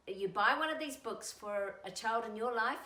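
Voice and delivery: ordinary delivery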